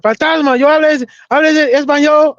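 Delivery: half-voiced coworker catching him. Coworker catches him speaking Spanish, lightly accusing. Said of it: "he said".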